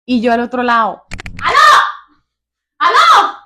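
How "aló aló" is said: loud high hello down the line; calling out. Retold or imitated. Wife calling to silent husband on phone, loud and high. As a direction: shout into a dead phone line.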